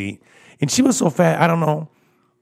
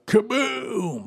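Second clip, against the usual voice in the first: door slam sound; 14 semitones higher than usual. Retold or imitated.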